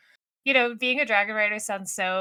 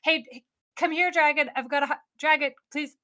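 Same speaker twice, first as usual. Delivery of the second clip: coaxing call; 6 semitones higher than usual. Retold or imitated. Crewman coaxing dragon into harness.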